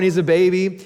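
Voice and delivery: ordinary delivery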